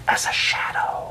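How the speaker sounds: hushed, voiceless ninja whisper; stealthy whisper. A narrator's reading. Ninja boasts of being silent.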